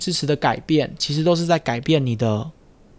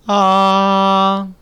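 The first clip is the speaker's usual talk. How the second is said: "啊" much higher and louder, ringing forward; high loud resonance demo; 6 semitones higher, 6 dB louder than usual. Shown as a demonstration. Speech therapist contrasting a louder, higher placement. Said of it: "都不同，对不对".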